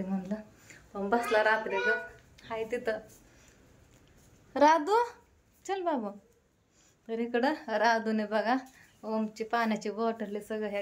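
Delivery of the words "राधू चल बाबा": calling out. Real, not acted. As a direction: call Radhu loudly, voice up high. Mother calls daughter to leave. (मुलीला मोठ्याने, उंच आवाजात हाक मारणे)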